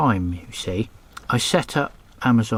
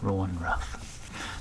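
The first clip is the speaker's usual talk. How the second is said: low, slow, much softer; hushed; 4 semitones lower, 11 dB quieter, much slower than usual. Shown as a demonstration. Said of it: "repeated take".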